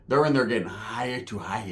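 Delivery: ordinary talk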